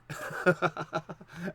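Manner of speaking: real burst of laughter; laughing. Real, not acted. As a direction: break into an amused laugh. Laughs while listing accents.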